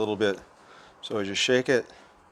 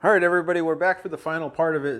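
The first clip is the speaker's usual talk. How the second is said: excited greeting; 6 semitones higher, 5 dB louder, faster than usual. Real, not acted. Back on camera, greets viewers.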